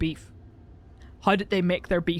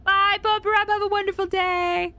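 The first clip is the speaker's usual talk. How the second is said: high, bright sing-song farewell to a viewer; sunny high-pitched goodbye; chirpy sign-off; 10 semitones higher than usual. Real, not acted.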